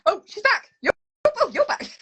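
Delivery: high-pitched surprised exclamation; delighted surprise, pitched well up; pleased surprise. Real, not acted. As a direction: exclaim it high as the caller reappears. Frozen caller suddenly reappears.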